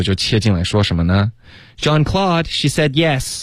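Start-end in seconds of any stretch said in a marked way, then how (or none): 1.8-3.4 high-pitched call across the room. Acted. Rachel cutting in to tell John Claude, high-pitched call. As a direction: act out calling over to someone, pitched way up. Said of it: "对着那边大喊一声说".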